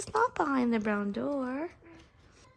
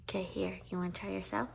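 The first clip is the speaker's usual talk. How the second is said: whispering; 6 semitones lower, 9 dB quieter, much faster than usual. Real, not acted. Whispering while brushing teeth.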